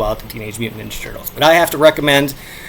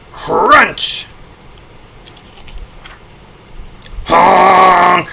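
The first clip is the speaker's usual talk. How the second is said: comic sound effects; 3 semitones higher, much slower than usual. Shown as a demonstration. Vlogger acting out comic sound effects, booming. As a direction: shout each sound effect like the comic panel. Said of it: "dramatic reading".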